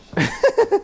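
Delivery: high, shrieking witchy cackle; witch cackle. Real, not acted. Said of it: "That sounded very witchly".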